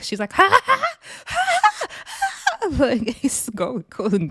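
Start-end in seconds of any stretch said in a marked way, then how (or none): 0.3-1.9 very high mimicked manic cackle; manic laugh. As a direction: imitate a laugh tipping into mania. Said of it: "that turns in manic".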